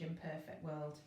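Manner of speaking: ordinary delivery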